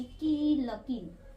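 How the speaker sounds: की लकीर said slowly, syllable by syllable; a teacher reading a word to a child, slow; slow blending. Shown as a demonstration. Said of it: "दोनों को एक ही साथ पढ़ेंगे".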